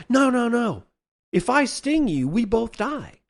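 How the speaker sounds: pleading scorpion. Acted. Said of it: "And then the scorpion says".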